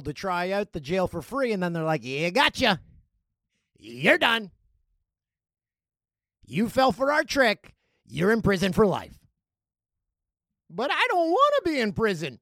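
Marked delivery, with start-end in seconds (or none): 2.0-9.2 loud, taunting jailer; spring the trap on them, loudly; mock-triumphant announcement, punched out; gloating gotcha. Acting gloating jailers tricking volunteers. 10.7-12.4 high, loud whine of protest; wailing comic complaint; mock protest. Acting the tricked volunteer protesting.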